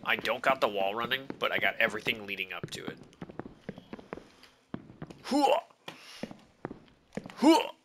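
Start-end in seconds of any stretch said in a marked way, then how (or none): 5.2-7.7 high, loud army cheer; loud gung-ho soldier. Acted. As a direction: bark it like a pumped-up marine.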